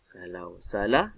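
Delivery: low "Kalau salah", rising on "salah", then stop; pause after salah. Shown as a demonstration. Teacher showing the middle stop, rising pause after "salah". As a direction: lift the end of "salah" and leave it hanging. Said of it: "dia berhenti di tengah".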